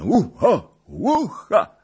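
high, loud festive yelps; joyful whoop. A narrator's reading. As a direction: whoop like a wedding reveller. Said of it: "ozwały się radosne". (radosne pokrzykiwanie)

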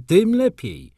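tym lepiej shot up high and loud; gleeful outburst. Acted. The doctor relishing his advice, gleefully shouting. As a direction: burst out with relish on the repeat. Doctor repeats with growing glee.

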